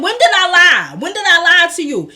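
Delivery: loud, high retold demand; indignant challenge. Retold quarrel, denies lying.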